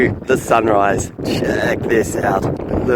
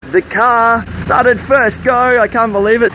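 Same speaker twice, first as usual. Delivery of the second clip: excited; 9 semitones higher, slower than usual. Real, not acted. Car starts in freezing cold.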